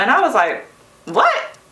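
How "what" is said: sharp, high disbelieving "What?"; the word leaps up in shock. Retold or imitated. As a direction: jolt up high in pure disbelief. Hearing her brother had died.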